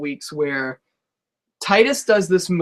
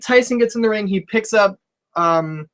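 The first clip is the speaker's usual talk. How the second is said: ordinary delivery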